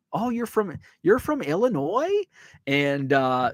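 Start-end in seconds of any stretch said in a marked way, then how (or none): 0.1-2.2 put-on high, lilting Fargo accent. Fargo bartender asks visitors where they're from, in a high Fargo accent. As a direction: imitate a curious Fargo bartender, pitched up. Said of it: "that's how they talk in Fargo".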